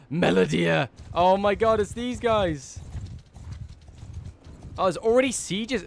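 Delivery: gasp, then a loud, high "oh my god"; thrilled to recognise them, slowing on the last words; excited gasp. Real, not acted. Player finding a favourite lord, gasping with excitement.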